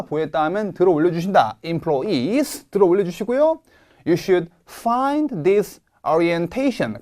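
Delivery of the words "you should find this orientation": orientation stressed on its ta syllable; slow, press the ta in orientation. Shown as a demonstration. Teacher reading out for students to copy. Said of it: "강세가 태에 옵니다".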